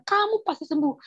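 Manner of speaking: high-pitched, certain promise; convincing assurance. Retold or imitated. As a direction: imitate the neighbour promising firmly. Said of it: "dia meyakini saya". (menirukan janji yang meyakinkan)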